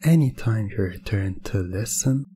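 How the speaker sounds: ordinary talk, nothing marked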